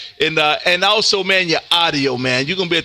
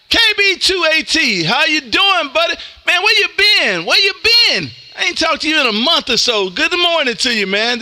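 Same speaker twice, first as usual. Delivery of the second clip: excited greeting; 8 semitones higher than usual. Real, not acted. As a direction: hail a buddy you missed, voice pitched up. Greeting a returning radio friend.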